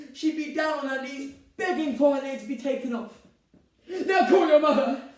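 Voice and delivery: very high, loud protest; heated rebuttal. Acted. Bride defending her stepmother to groom, heated. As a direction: argue shrilly and end on an order. Catherine argues back.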